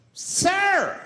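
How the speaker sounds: high, loud outraged "Sir!"; freaked-out shout. Retold or imitated. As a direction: call out sharply in alarm. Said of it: "people freaked out".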